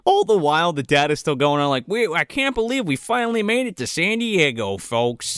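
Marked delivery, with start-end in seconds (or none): 1.9-5.4 higher-pitched mock tourist dad; hammed-up holiday video commentary, dropping at the end; camcorder dad. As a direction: imitate a dad presenting to his video camera. Dad narrating into his video camera.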